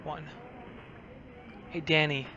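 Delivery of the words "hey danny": normal talking voice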